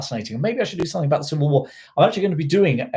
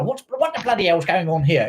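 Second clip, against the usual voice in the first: put-on nan storming in with a higher-pitched North London accent; indignant North London outburst, imitating nan; 5 semitones higher than usual. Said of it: "in a North London accent".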